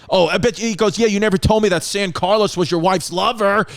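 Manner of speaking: high mocking jab; a hint of a petty emperor's sneer; sneering taunt. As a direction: taunt him with a cruel secret. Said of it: "He was being like that, like a dick".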